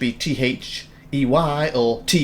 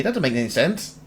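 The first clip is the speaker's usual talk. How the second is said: ordinary delivery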